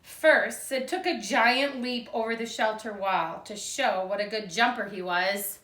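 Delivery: put-on lively read-aloud for young children; storybook reading. A narrator's reading. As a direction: read it like a picture book to second graders. Teacher reads picture book aloud.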